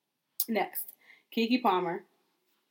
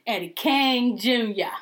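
gloating drawl; 3 semitones higher, 5 dB louder, slower than usual. Real, not acted. Drawn-out gloat.

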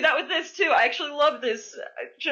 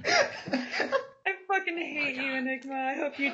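mock outrage; 5 semitones higher, 6 dB quieter, slower than usual. Mock anger while laughing.

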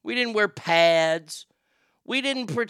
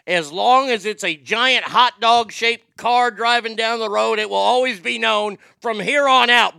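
loud, high wrestler rant; wrestling promo rant; 4 semitones higher, 6 dB louder than usual. Acted. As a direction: roar it like a heel wrestler. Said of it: "wrestling promo continues".

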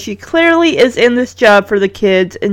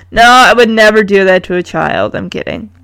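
ordinary delivery, nothing marked

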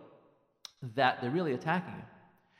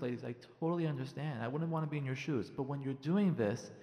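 ordinary delivery

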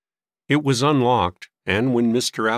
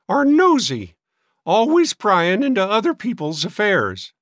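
very high, loud disapproval; querulous old maid; 10 semitones higher, 5 dB louder than usual. A narrator's reading. Sister complaining about detectives, disdainful. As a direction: scold in a high cracked voice.

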